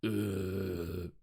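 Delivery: deep zombie groan. Pretend. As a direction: moan low like the undead. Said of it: "good zombie impression".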